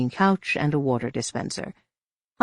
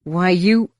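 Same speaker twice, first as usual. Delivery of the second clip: anger; 4 semitones higher, 7 dB louder than usual. A narrator's reading. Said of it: "unreasoning anger rose in him".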